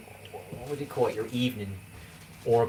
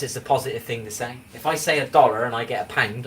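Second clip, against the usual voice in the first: normal talking voice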